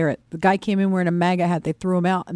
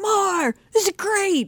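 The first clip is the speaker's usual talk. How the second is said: put-on much higher excited child voice; imitating thrilled kid; 13 semitones higher, much slower than usual. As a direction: mimic your little boy loving every second. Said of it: "this kid can't get enough".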